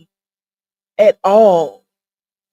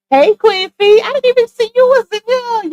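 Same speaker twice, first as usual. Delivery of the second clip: much higher, louder delighted hello; surprised greeting; 12 semitones higher, 5 dB louder than usual. Real, not acted. Streamer excitedly greeting a viewer she missed. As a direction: greet her like she just walked in.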